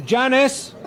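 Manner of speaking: calling out. Acted. Ordering another round across pub.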